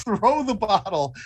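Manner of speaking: ordinary delivery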